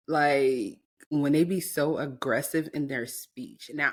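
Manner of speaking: ordinary talk, nothing marked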